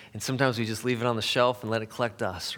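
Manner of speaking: usual speaking voice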